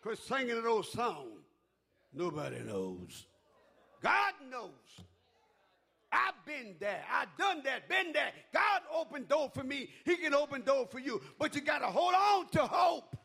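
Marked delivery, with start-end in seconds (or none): 4.0-13.2 loud, high rousing sermon cry; high fervent preaching shout; preaching at full force. Acted. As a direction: build the church up to hope. Preaching climax.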